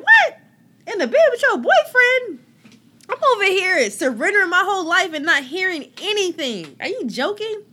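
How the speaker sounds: high, loud disbelieving shriek; shocked outrage. Retold or imitated. Friend heard God in bed with boyfriend, incredulous high shriek.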